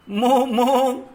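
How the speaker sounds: drawn-out moo moo; cow moo. Shown as a demonstration. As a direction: imitate a cow lowing. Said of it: "Now the cow".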